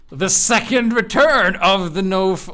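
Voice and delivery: hyped-up title reveal; grand announcement. Acted. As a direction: announce it like a big comeback, pitch up. Grand reveal of next week's loadout.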